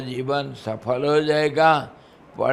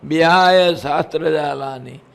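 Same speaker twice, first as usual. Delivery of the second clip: raised, intoned verse; scripture recitation; 7 dB louder than usual. Acted. Preacher reciting scripture to listeners, intoned. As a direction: chant it to the hall.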